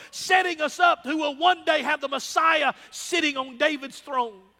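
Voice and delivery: fervent pulpit delivery; preaching at full force. Acted. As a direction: preach it out to the congregation. Preaching the Samuel story.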